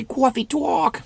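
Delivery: high-pitched New York "cawfee tawk"; New York accent. Acted. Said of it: "It's a New York accent".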